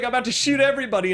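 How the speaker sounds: ordinary delivery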